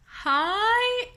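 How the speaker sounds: very high, drawn-out hi; bewildered greeting. Retold or imitated. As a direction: squeak a long, comically unsure hello. Second greeting, exaggerated bewilderment.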